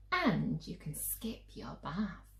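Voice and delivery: whispered like a secret bribe. Acted. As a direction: lean in and whisper the offer. Mum offers a secret treat.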